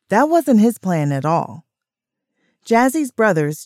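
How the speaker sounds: plain reading voice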